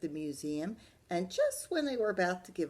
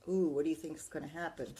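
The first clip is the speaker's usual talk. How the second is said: ordinary delivery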